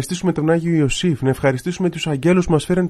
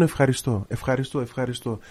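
each ευχαριστώ softer until almost a whisper; fading thanks; 6 dB quieter than usual. Shown as a demonstration. Priest shows the listener how to keep thanking, growing softer. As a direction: let the thanks fade away gently. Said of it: "Να λες μόνο".

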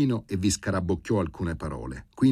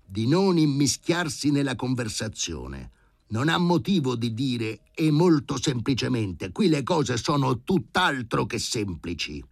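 growled; 6 semitones higher, 4 dB louder than usual. A narrator's reading. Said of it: "ringhiò Filip Filipovic".

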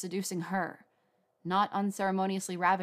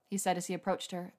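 ordinary delivery

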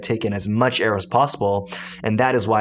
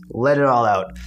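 ordinary delivery

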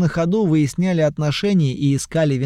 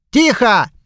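sharp shout; 7 semitones higher, 6 dB louder than usual. Acted. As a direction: bark a single order. Master stops the fight.

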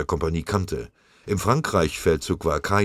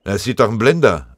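loud, higher, angry retort; furious; 5 semitones higher, 9 dB louder than usual. A narrator's reading. Sergeant answering the company runner, furious. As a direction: fire back at him in anger. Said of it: "antwortete Scharf wütend".

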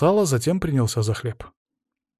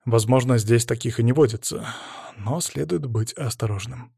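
plain reading voice

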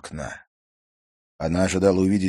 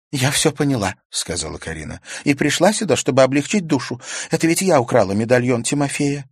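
put-on higher young girl's voice, rueful; girl Karina voiced; 8 semitones higher, 7 dB louder than usual. A narrator's reading. Karina admits her foolishness to Stas, higher girl's voice. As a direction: play Karina, higher and lighter, sheepish.

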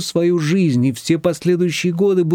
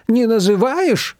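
higher, slow incredulous exclamation; exclaiming; 10 semitones higher, slower than usual. A narrator's reading. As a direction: stretch out the disbelief. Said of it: "воскликнула Манана".

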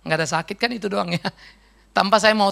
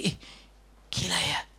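whispered inner thought; 5 semitones lower, 11 dB quieter than usual. Retold or imitated. Inner thought about putting on socks.

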